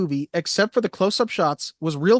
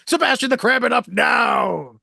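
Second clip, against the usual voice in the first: loud, higher-pitched mock order, last words stretched out; hammed-up executive yelling; mock boss shout; 6 semitones higher, 3 dB louder, slower than usual. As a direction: bellow like an exasperated studio boss. Imitating chairman's angry phone call.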